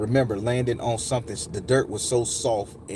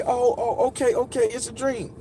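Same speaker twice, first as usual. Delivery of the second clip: shaken relief; 13 semitones higher than usual. Retold or imitated. Child relieved, still shaken.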